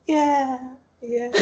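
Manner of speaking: tiny timid voice holding in excitement; shy excitement. Acted. Yandy struggling to explain her excitement, small and excited. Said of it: "Very quietly, very excited".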